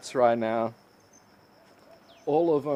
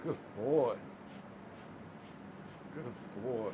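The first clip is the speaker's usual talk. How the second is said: cooing softly; 2 semitones lower, 15 dB quieter than usual. Real, not acted. Praising pet dog softly.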